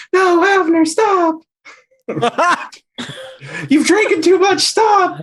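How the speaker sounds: very high shrill pleading cry; mimicked voice. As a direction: squeal it high and laughing. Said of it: "mimic the voice".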